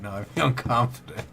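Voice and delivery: laughing mid-word. Real, not acted. Streamer cracking up after the ASMR try, laughing. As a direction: break into a laugh on confident. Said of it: "That was fucking hilarious".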